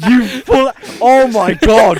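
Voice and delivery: loud, high, drawn out through laughing; real laughing disbelief; helpless laughter. Real, not acted. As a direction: exclaim while cracking up. Both cracking up over the name.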